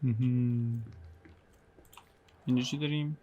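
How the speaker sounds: normal talking voice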